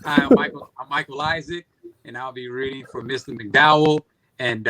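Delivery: ordinary delivery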